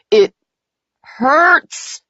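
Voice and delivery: high, agonised outcry; cry of pain. A narrator's reading. Man writhing in his nightmare, pained cry.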